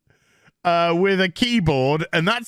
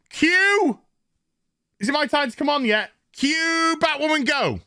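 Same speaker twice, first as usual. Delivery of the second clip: mock stage cue; 7 semitones higher than usual. Mocking a stage cue for Batwoman.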